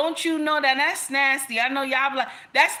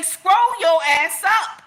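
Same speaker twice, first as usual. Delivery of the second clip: sharp, higher, louder dismissal; telling them off; 6 semitones higher, 5 dB louder than usual. Real, not acted. Dismissing bitter viewers in her comments, loud and sharp. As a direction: shoo the haters away loudly.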